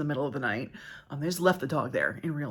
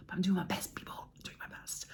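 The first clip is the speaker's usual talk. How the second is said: whisper; 11 dB quieter than usual. Real, not acted. Apologizing to viewers for slow replies.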